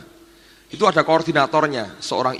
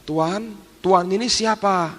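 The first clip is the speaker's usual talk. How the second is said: timid, higher-pitched mock fearful questioning; imitating frightened woman; 6 semitones higher, slower than usual. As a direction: ask like a scared servant, voice pitched up. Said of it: "Sambil takut dia bilang".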